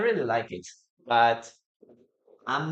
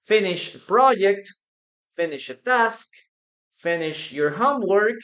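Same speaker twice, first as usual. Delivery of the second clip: lively examples; 5 semitones higher, 7 dB louder than usual. Shown as a demonstration. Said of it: "give me some sentences using finish".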